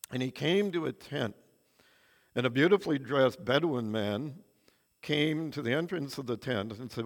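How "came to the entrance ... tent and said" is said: usual speaking voice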